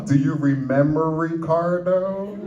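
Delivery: stretched-out, rising coaxing question. Acted. Uncle cheering up a crying nephew, playfully coaxing. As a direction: draw the name out slowly, climbing in pitch.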